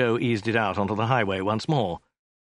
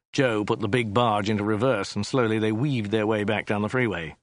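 usual speaking voice

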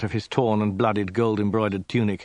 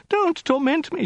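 very high falsetto wail; 4 dB louder than usual. A narrator's reading. Woman pleading at Khan's feet, wailed. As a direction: wail it as a desperate plea. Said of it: "she wailed".

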